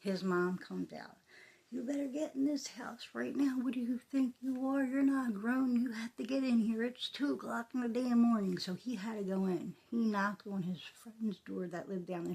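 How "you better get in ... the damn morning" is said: higher, louder scolding mom voice. Retold or imitated. Friend's mom ordering her son indoors at night, angry scolding. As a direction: scold the kid to get inside now.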